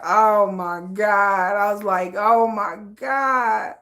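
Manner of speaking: very slow, drawn-out disbelief; drawn-out, slowed oh my God; slow dismay. Retold or imitated. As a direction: stretch it out in despair.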